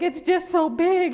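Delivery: high, loud mock whine; hammed-up tearful complaint, high and slow; tearful customer. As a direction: imitate an upset girl, drawn out. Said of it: "she wanted to bust out crying".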